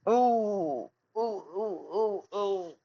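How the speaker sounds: stubbed toe ooh sounds; pained moaning. Real, not acted. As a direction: moan through the throbbing. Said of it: "Ow, my toe".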